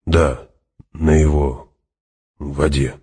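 word by word, falling and deliberate; halting. Acted. Hawat confirms his oath to Fremen, halting and grave. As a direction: pause between words as you confirm the oath.

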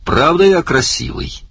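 high-pitched preening boast; boastful. A narrator's reading. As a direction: show yourself off, voice leaping up high. Vain actor shows himself off. (хвастливо, высоким голосом)

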